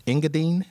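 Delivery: Engadine said as a tentative question, stress on the wrong syllable; unsure pronunciation. Real, not acted. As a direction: try Engadine carefully, pitch rising as if guessing. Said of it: "put the wrong emphasis on the wrong sy-, syllable".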